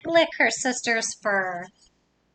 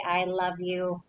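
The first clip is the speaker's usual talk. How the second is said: soft, lower i love you; tender i love you; 5 semitones lower, 5 dB quieter than usual. Acted. Reading to kids, the whale saying it tenderly. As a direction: breathe it softly to a loved one.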